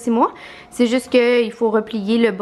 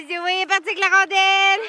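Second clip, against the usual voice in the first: excited; 9 semitones higher than usual. Real, not acted. Dog runs off with puck.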